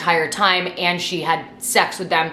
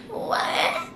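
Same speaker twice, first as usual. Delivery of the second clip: high, soft, drawn-out disbelief; breathy mock echo of his words, half whispered; sarcastic soft replay; incredulous mock repeat; 8 semitones higher, 6 dB quieter, much slower than usual. Mocking the maintenance man's reply.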